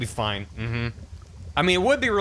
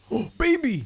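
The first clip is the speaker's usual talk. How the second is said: excited; 12 semitones higher than usual. Real, not acted. Excited at new area.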